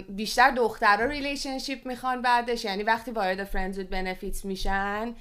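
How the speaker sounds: ordinary talk, nothing marked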